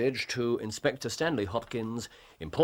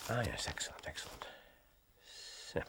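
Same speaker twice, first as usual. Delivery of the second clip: soft, fading to a whisper; pleased murmur; 14 dB quieter, faster than usual. Acted. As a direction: savour a private discovery under your breath. Holmes reading the paper pleased.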